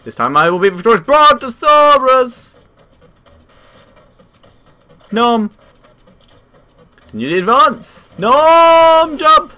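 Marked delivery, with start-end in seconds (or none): 1.0-5.5 high, slow dramatic yell; epic battle cry. Pretend. 8.2-9.5 high excited shouts; frantic yelling. Real, not acted. Frantic play urging.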